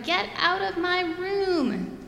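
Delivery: put-on high, slow shouted child's cry; shouting. Bella shouting at her baby brother, high and slow. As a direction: yell it like an angry little girl. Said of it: "So I shouted".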